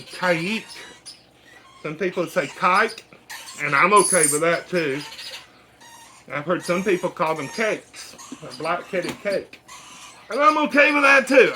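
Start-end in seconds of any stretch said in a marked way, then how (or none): 0.0-0.6 "Kayik" said as KY-eek, stress on the first syllable; correct pronunciation. Shown as a demonstration. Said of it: "that is how you pronounce the name". 10.3-11.5 high, loud comic outburst; mock shout. Real, not acted. Repeated line, second shouted.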